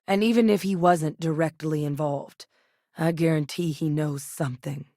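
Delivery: ordinary talk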